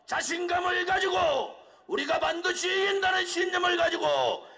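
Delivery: high shouted rally speech; roaring rallying speech. Real, not acted. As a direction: urge the crowd on, full force. Rally climax repeated call. (포효하는 집회 연설)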